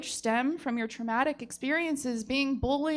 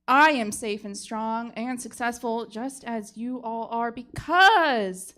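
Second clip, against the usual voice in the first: louder and louder, peaking in a yelled "Oh, no"; fired-up closing rant; 8 dB louder than usual. Acted. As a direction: preach it to the jury until it slips out. Lawyer's closing speech boils over.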